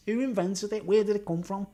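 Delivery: usual speaking voice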